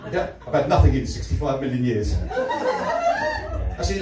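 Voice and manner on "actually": laughing. Real, not acted. Laughing break mid-line.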